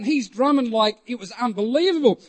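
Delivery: normal talking voice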